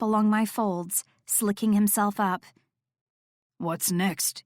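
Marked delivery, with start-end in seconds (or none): 3.6-4.4 low musing tease; musing darkly. A narrator's reading. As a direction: muse on it, low and wicked. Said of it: "he mused with a dark".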